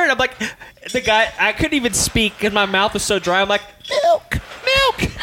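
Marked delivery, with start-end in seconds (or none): 3.9-5.1 high strained choking voice; croaky desperate plea. Retold or imitated. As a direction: gasp it out like your mouth is on fire. Choking, begging server for milk.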